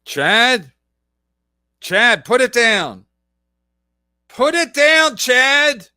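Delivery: loud, drawn-out yell at Chad; mock shouting plea. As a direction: bark it like a panicked parent. Mocking plea to drug user.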